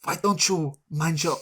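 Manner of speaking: badly faked Swedish accent; attempted Swedish accent. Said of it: "It's a very intense Swedish voice".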